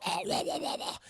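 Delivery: soft voiceless garbled choking; imitated gurgling. Wounded warden answers the turret, mock gurgling. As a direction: gargle nonsense as if shot in the throat.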